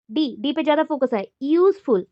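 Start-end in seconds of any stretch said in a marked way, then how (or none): 1.4-2.1 "useful" with a strong यूज; stress on first syllable. Shown as a demonstration. Teacher giving an example word, stressing यूज in "useful". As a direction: punch the opening यूज of "useful". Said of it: "stress on first syllable".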